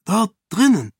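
hoch und laut ungläubig; entsetzt. A narrator's reading. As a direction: ein erschrockener Ausruf. Ahan entsetzt über Elantris. (Ahan fragt Sarene laut und entsetzt nach)

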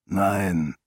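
a hint of grave gruffness; rough, serious voice. A narrator's reading. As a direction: refuse gravely in a lightly roughened voice. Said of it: "Seine raue Stimme klang ernst". (leicht raue, ernste Stimme)

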